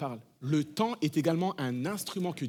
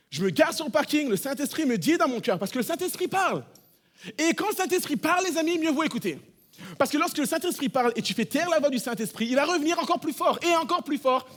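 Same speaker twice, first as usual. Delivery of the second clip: high, loud preacher's cry; fervent preaching; 9 semitones higher, 5 dB louder than usual. Acted. Pastor urging the congregation to heed the Spirit, fervent. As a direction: preach it out with rising fire.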